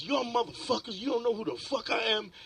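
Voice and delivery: high, fast tough-guy threat; mock robber threat. Masked robber threatening the gamblers, high and fast. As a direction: menace the room like a masked robber.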